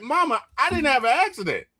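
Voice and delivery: high, fast and bewildered, just woken up; groggy high-pitched confusion. Retold or imitated. Son just woken by mom, high and confused. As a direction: play the dazed son squeaking up in disbelief. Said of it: "I was like".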